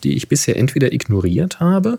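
reading aloud. Acted. Reads listener question aloud.

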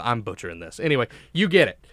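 ordinary talk, nothing marked

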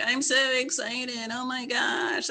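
a hint of a thrilled classmate; mock excited girl. Mom imitating a girl celebrating her pregnancy test, lightly mock excited. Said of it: "she was like".

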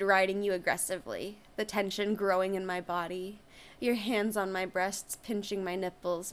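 ordinary delivery

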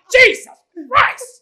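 very high, loud cry of dismay; mock outburst. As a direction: yell it out like a shocked curse. Dismay at the paper.

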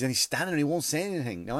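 ordinary talk, nothing marked

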